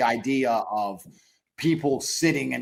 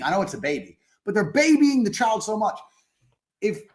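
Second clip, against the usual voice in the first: normal talking voice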